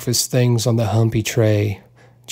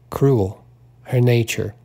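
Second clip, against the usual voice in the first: plain reading voice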